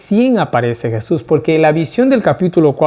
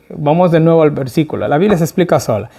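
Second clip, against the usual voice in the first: ordinary delivery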